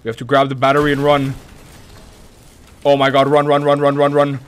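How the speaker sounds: panicked rushing; hurried frightened, urgent and loud; being chased. Real, not acted.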